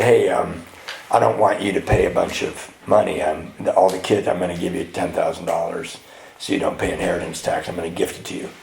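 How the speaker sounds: low, subdued dying father; imitating sick dad. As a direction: speak low and gently, with little strength. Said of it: "my dad was very, very sick".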